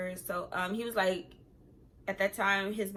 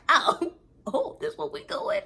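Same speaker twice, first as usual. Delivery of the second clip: amused disbelief; 12 semitones higher, slower than usual. Retold or imitated. Mock surprise at boyfriend's phone.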